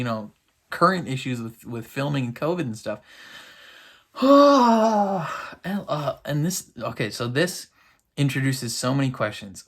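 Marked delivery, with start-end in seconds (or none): 4.2-5.6 loud, high, drawn-out sigh; exasperated sigh. Real, not acted. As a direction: let out a big, long sigh. Reacting to a long wait.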